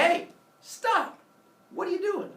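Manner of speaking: high, urgent mock shout; calling out. Prometheus running in toward the humans, calling out. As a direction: call a sharp halt in a high voice.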